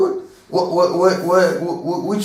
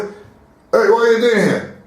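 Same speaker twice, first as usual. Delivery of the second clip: sharp, raised challenge to a stranger; hostile challenge; 5 semitones higher than usual. Retold or imitated. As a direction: confront him like an angry neighbor. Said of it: "If you will speak like this".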